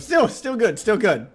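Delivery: excited praise. Real, not acted. Praising the bit.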